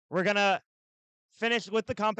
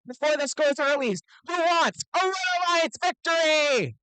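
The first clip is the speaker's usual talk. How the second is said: put-on broad singsong kids theatre. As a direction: pump up the crowd like an emcee. Event announcer hyping the crowd.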